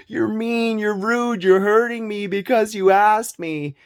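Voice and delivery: high, whiny mock mother. Mother accusing her son of abuse, high whiny mock. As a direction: play the wounded accuser. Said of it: "She will say".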